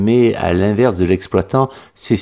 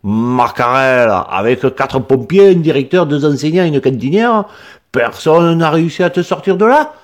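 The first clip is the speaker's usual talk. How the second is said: put-on accent du Midi, plus haut, étonné; le paysan n'en revient pas; exclamation méridionale; 7 semitones higher, 4 dB louder than usual. A narrator's reading. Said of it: "s'exclama-t-il". (accent provençal stupéfait)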